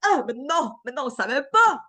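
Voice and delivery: hammed-up surprise; fake surprise. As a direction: overplay the shock like you never knew.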